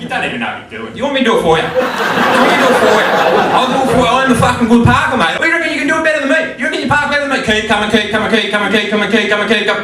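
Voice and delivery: loud, high, exasperated Australian car voice; broad Australian mate taking over the parking, loudly; Aussie accent. Acted. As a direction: nag the driver in a hammed-up Aussie accent. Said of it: "that'd be Aussie accent going".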